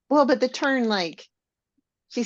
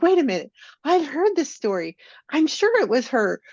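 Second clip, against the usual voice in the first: surprised; 8 semitones higher, 5 dB louder, faster than usual. Retold or imitated. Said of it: "I'm like".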